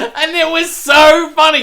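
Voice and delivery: high, loud, slow through laughter; laughing. Real, not acted. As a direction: squeeze it out while cracking up. Still laughing at the story.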